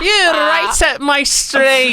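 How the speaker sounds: put-on high, loud, slow Liverpool accent; drawn-out Scouse impression. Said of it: "famously what [name] said".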